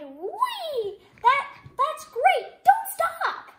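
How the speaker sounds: a high, loud, laughing cheer; excited frog cry. A narrator's reading. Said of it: "and Frog cried".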